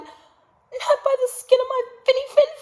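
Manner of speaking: high, loud, frightened squeak; little fish squeaking back at the shark; trembling little fish. Acted. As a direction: squeal it out in a panic. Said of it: "The little fish trembled and replied".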